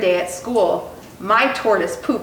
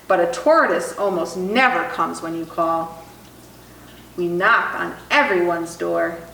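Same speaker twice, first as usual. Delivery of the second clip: usual speaking voice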